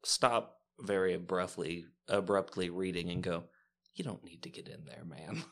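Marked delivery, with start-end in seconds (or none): 3.9-5.4 hushed, breathy whisper to a friend; a quick hushed warning, almost secret. Acted. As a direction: lean in and whisper it privately. Barlow whispers advice to Griff.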